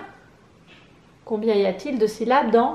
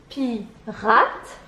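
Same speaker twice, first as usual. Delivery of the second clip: "Pirate" as pi, pause, rate; syllable by syllable. Shown as a demonstration. Teacher presenting an image, "Pirate" separated as pi, rate. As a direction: split "Pirate" into two beats.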